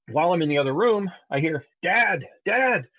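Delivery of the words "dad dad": put-on panicked toddler. Dad retelling his toddler's panicked call from the next room, high and frantic. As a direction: cry out like a scared little kid. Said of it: "he sounds panicked".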